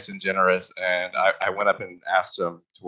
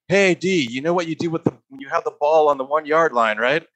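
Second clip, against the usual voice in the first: imitating a raised-voice call across a room; retold yell; 5 semitones higher, 4 dB louder than usual. Actor retelling a co-star's call across the set, retold yell. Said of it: "Johnny C yells to me from across the room".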